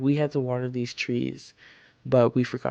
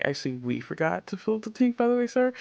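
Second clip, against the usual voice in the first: pointed mock reply; 9 semitones higher than usual. Correcting an upset coworker over the tank, rising mock-polite reply. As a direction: pitch climbing high at the end.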